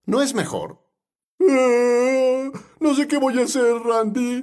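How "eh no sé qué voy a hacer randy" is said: high, drawn-out mock whine; whining congregant. Congregant whining to the pastor, mock helplessness. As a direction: whimper helplessly, exaggerating the complaint.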